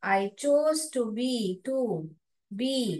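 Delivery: slow and careful; slow model reading. Shown as a demonstration. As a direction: read it out slowly. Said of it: "model reading". (धीरे पढ़ना)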